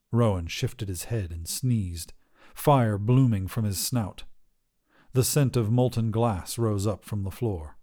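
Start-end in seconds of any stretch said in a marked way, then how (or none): none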